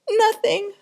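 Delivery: a high, startled yelp; jittery yelp. Acted. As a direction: squeak it out, caught off guard. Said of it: "he yelped".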